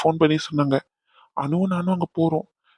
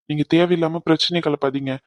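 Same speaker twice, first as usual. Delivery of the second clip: ordinary talk, nothing marked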